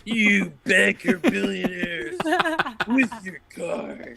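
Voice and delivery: slow, high sneering rant; slow ranting robber. Acted. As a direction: drag out the insult slowly. Robbery role play.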